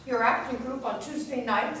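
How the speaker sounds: normal talking voice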